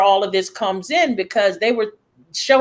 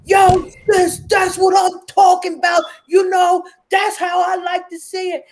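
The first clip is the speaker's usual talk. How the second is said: street shout; 7 semitones higher than usual. Retold or imitated. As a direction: yell it up to the pole like a passerby. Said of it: "was screaming".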